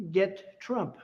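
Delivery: ordinary talk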